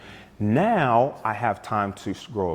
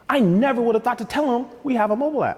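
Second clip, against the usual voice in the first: amused disbelief; 12 semitones higher, 3 dB louder than usual. Real, not acted. Laughing at own sales story.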